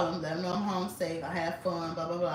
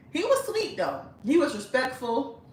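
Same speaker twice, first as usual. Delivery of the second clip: put-on Nigerian accent; 6 semitones higher than usual. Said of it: "I'ma do the accent".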